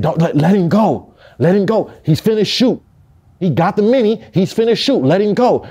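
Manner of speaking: frantic homie shouting let him go; urgent plea. Retold or imitated. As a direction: call out to stop the choke. Homie urging him to release.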